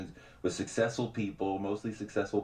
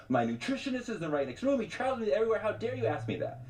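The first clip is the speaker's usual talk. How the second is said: high, fast mock outrage; hammed-up rapper taking offense; mock offended rapper; 10 semitones higher, faster than usual. Rapper snapping at the interviewer, mock offended. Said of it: "he got very upset".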